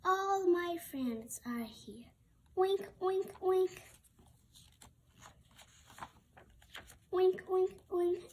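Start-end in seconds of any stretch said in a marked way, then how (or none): 2.6-8.2 high, loud oinking pigs; a crowd of pigs calling out; pig oinks. Acted.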